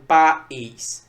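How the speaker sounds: "país" pulled apart as PA - ÍS; pa... ís for "país", each syllable on its own; split into syllables. Shown as a demonstration. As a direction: break "país" between the A and the Í. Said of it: "Como é que eu separo".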